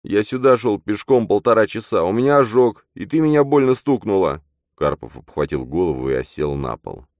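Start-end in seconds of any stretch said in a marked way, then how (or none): 0.0-4.4 lamenting. Acted. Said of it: "стенал Матвей".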